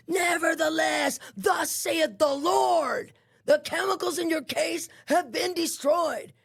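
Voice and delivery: high, stretched-out preacher's cry; imitating a prophet, solemn and drawn-out; prophetic proclamation. Woman declaring the Lord's word through the vent, prophetic proclamation.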